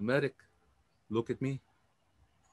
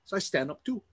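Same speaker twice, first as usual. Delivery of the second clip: ordinary talk, nothing marked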